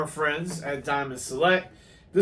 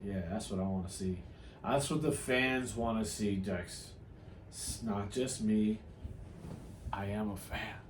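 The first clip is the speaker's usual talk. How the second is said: quiet aside; 8 semitones lower, 14 dB quieter than usual. Real, not acted. Talking quietly while guest searches.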